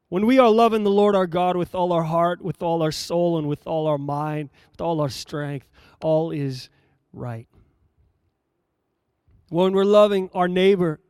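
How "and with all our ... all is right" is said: ordinary talk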